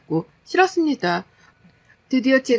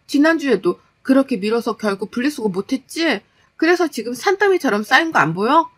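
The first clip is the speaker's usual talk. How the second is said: higher-pitched exasperated wife; nagging, annoyed wife; 3 semitones higher, 4 dB louder than usual. Acted. Wife scolding husband over piled-up recycling, exasperated. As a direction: nag him with rising impatience.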